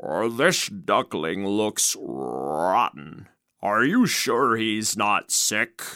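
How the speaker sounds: low, slow croaking frog; booming frog croak. A narrator's reading. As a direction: croak it out like a big bullfrog. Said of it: "with his booming croak".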